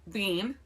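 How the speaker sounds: "bean" with a long ee; said as spelled. Shown as a demonstration. Host reading the spelling first, "bean" with long ee. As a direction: read "bean" just as written. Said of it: "I'm gonna say it the way it's spelled".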